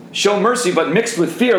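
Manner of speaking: high, ringing preacher's call; forceful preaching. Acted. Preacher urges his men, high and forceful. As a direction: proclaim it to the room.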